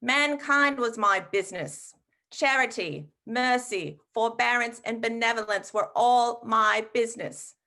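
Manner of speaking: theatrical British ghost lament, wringing his hands; bad English accent. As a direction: boom it out like a mournful ghost, in mock-British. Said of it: "in a very bad English accent".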